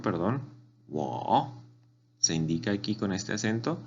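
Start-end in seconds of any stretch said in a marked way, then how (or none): 0.9-1.6 "Wo" starting low and rising; third tone. Shown as a demonstration. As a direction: dip "Wo" low, then lift it. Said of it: "Es un tono de abajo hacia arriba".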